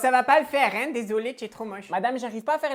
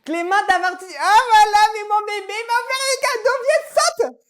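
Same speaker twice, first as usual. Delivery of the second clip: put-on much higher and louder, squealing over her baby; gushing mum; 11 semitones higher, 10 dB louder than usual. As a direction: coo hysterically at the favourite son. Mère s'extasie sur son fils.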